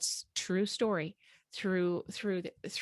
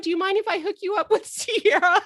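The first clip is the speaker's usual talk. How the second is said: laughing; 10 semitones higher, 7 dB louder than usual. Real, not acted. Joking with Radwa, laughing.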